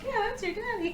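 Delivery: lightly acted, high reassuring mom; placating mom. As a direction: imitate a mother fobbing off a child.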